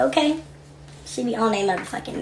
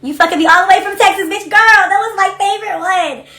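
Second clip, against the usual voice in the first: higher, louder, giddy recall; gleeful hype; 9 semitones higher, 6 dB louder than usual. Real, not acted. Host gushing to viewers about her favorite clip, gleeful. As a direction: relish it like your favorite memory.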